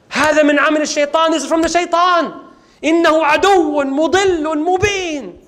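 high, urgent declamation; alarmed outcry. Acted. Musa blaming the shaitan for the death, alarmed high outcry. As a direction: cry it out urgently, high-pitched. Musa reacts in shock to killing.

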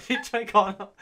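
high giggly reread; laughing through the words. Real, not acted.

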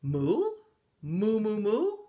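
loud moos climbing to a very high long one; rising, swelling cow calls; exultant mooing. A narrator's reading. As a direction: build to a big celebratory moo. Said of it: "said the pig".

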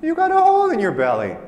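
high, loud, crowing jeer; triumphant taunt. Acted. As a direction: crow over the beaten wolf. Risen sheep taunts the wolf.

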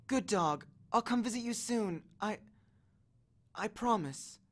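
soft, faltering farewell; melancholy, uncertain. A narrator's reading. Annabeth leaves Cerberus waiting, sadly promising. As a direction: promise gently, reluctant to leave. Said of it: "her voice sounded melancholy and uncertain".

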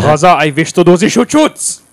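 hammed-up German soldier voice; German accent. Mock German persona, excited.

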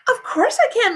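high, keen assurance; eager girl. Acted. Sheila assures mother she can babysit, high eager voice.

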